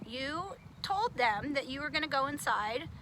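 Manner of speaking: usual speaking voice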